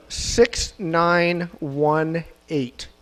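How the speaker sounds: slow called-out numbers. Real, not acted. Calling out raffle number.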